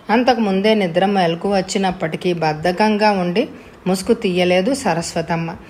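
plain reading voice

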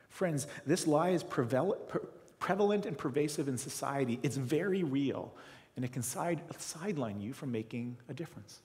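ordinary talk

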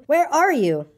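high, pleading storybook call; crying out. A narrator's reading. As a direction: call out to someone missing. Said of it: "cried Christopher Robin".

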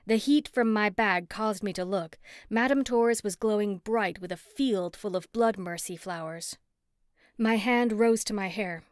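ordinary delivery, nothing marked

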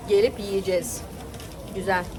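normal talking voice

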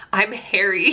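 normal talking voice

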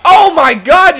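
very loud, high-pitched play-by-play yelling; excited shouting. Real, not acted. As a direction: yell every near miss in disbelief. Repeated chances miss in play.

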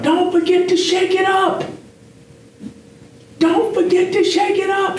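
high mock wife voice calling down the stairs. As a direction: imitate his wife yelling from upstairs, way up high. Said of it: "she yelled down".

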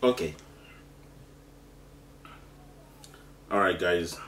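talking through burning spicy mouthful; mouth full of hot food. Real, not acted. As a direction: speak with a mouth on fire. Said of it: "my mouth is on fire".

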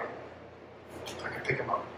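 breathless near-whisper, fighting tears; barely voiced, overcome by emotion; whispering through grief; choked whisper. Real, not acted. Emotional memory of dying father.

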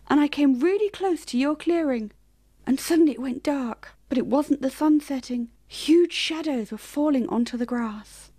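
anxious rabbit recounting what he saw; storytelling rabbit. Acted. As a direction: tell the dark news carefully. Clover's long account.

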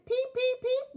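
high, bright peeping; little engine whistle. A narrator's reading. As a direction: pipe it out like a small whistle. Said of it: "whistled Thomas".

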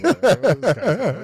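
high, loud incredulous outburst; friend's incredulous complaint. Acted. Friend Donnie griping about the speaker, high mock-incredulous voice. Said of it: "he's like".

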